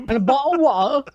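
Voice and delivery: high mock British voice; thick British accent. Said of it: "your British accent was so thick".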